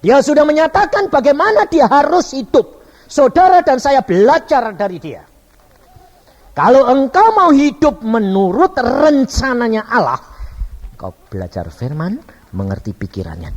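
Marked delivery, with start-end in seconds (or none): 0.0-10.2 high, loud, ringing proclamation; full-force preaching. Real, not acted. Preacher exhorts congregation to learn from Jesus, loud and high.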